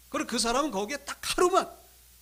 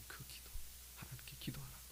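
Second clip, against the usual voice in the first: hushed recollection; 8 semitones lower, 18 dB quieter than usual. Retold or imitated. Recalling the sister's whispered advice.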